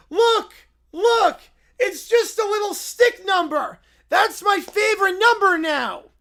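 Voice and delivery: put-on high, loud, slow childlike bear; Little Brown Bear voice. Little Brown Bear marveling at the one point, high and slow.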